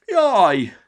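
high, loud pained yelp; cry of pain. A narrator's reading. Petya crying out in pain when bitten, high and loud. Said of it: "kiáltott fel fájdalmasan".

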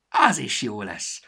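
loud, slow cheerful answer; cheerful reply. A narrator's reading. Storybook cheerfully accepting the threat, loud. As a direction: answer happily, unbothered. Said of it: "felelte vidáman a kalandregény".

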